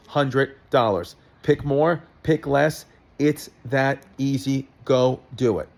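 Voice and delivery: steady sponsor read. Acted. Host reading a sponsor spot to listeners, ad read. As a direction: rattle off the ad copy.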